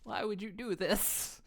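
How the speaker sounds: slow, hushed wounded whisper; slow whispered "Why would you do this?". Pretend. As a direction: breathe the question out slowly. Pelted with paprikas, whispering.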